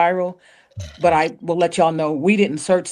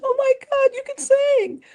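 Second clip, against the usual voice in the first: much higher, amazed mother; mother's amazed voice. Retold or imitated. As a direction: squeal it like a proud, stunned mom. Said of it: "she's like".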